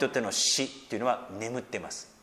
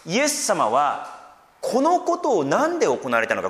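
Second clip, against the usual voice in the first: ordinary talk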